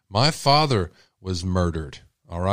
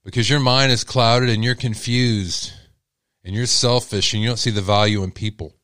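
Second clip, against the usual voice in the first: firm, contemptuous rebuke; addressing the murderer. Real, not acted. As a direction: tell him off coldly and deliberately. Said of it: "I want to say something to the murderer".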